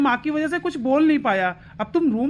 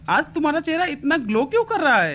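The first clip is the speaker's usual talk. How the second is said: ordinary talk, nothing marked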